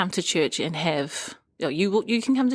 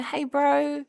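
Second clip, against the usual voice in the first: friendly greeting; 8 semitones higher than usual. Retold or imitated. Churchgoers greeting husband.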